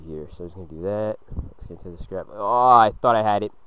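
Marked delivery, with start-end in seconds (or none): 2.3-3.5 frustrated yelp. Real, not acted. Frustrated gamer near miss.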